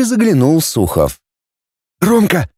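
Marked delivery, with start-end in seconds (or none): 2.0-2.5 higher, lightly husky call; slightly hoarse. A narrator's reading. Sukhov peeks in to summon Roma, slightly hoarse. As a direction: call a friend with a touch of hoarseness. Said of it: "измолвил он чуть осипшим голосом".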